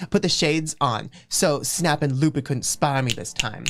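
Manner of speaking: ordinary talk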